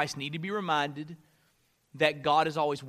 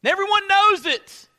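raised, high-pitched pleading prayer; crying out to God; 8 semitones higher, 6 dB louder than usual. Acted. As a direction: cry out loudly to God for help. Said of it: "you cry out to God".

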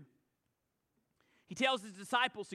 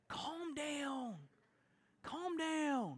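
soft, mock hushing voice; hushing churchgoer; 4 semitones higher, 5 dB quieter, much slower than usual. Religious folks hushing an excited believer, soft mock hush. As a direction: shush them like a stiff churchgoer. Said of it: "religious folks get uncomfortable".

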